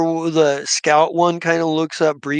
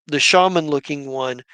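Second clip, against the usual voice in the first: normal talking voice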